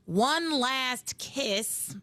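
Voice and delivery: slowly, savoring each word; drawn-out soap opera kiss line; slow dramatic quote. Retold or imitated.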